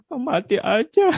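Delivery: high, slow, drained voice of despair; despairing thought. Retold or imitated. A frustrated parent thinks of giving up, slow and hopeless. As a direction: let the wish to give up slip out slowly.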